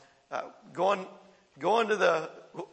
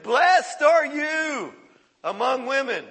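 higher, louder and very slow proclamation; exclaimed; 7 semitones higher, 7 dB louder, slower than usual. Shown as a demonstration. Preacher shows Elizabeth exclaiming to Mary, slow and loud. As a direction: proclaim it like a joyful cry. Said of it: "it says she exclaimed".

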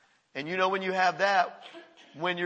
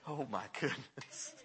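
amused mutter; 4 semitones lower, 11 dB quieter than usual. Retold or imitated. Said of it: "I'm sure he was laughing, going".

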